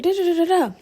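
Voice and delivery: mock aggressive. Showing how she gets a little aggressive, mock outburst. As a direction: rattle it off high as if going off. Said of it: "no need for me to be like".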